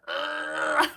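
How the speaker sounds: low, drawn-out mock groan; retold scream. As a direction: imitate a scream as a low growl. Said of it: "I screamed".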